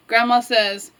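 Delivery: plain reading voice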